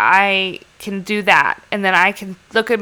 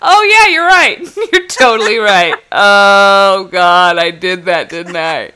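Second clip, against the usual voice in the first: sheepish laughing admission; 4 dB louder, slower than usual. Retold or imitated. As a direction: caught out, laughing at herself. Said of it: "And I'm like".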